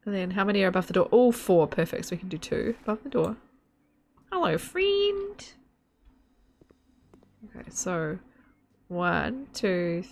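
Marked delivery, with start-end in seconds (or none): 4.3-5.4 very high, drawn-out mock Australian; imitated Australian accent. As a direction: stretch "friend" high in broad Australian. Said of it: "you trying to be me to say friend".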